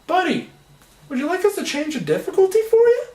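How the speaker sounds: high-pitched, patronizing helper voice; condescending game prompt. Retold or imitated. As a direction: pipe up as an over-helpful video game. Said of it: "the game pops up and it goes".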